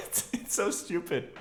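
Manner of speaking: amused laugh through the words; giggling at her own silliness. Real, not acted. Laughing at own bad accent.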